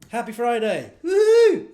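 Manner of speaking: loud sarcastic whoop, high; mock cheer. Real, not acted. Stood-up podcaster mocking his own Friday night, whooping. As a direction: cheer it out in bitter mock joy.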